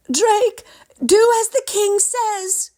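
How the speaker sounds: high, loud call after her son. A narrator's reading. Mother calling after her son as he rides off. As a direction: call out across the distance. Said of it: "his mother called out".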